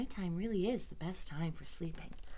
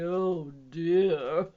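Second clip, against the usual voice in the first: loud, drawn-out elephant lament; 10 dB louder than usual. A narrator's reading. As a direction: groan it like a weary elephant. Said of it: "said the elephant".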